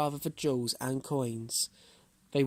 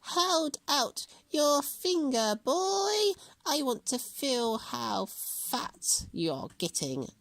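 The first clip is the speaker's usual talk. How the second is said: witch voice; 13 semitones higher, much slower than usual. A narrator's reading. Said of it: "the witch".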